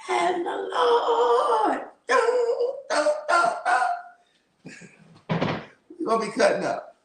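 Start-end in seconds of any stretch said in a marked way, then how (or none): 0.1-1.8 high, slow hooped cry; preaching whoop. Acted. Previewing her hoop and holler, high drawn whoop. 2.1-3.8 vocal dun dun dun; organ stabs. Acted. Imagining the organist playing, sung dun dun.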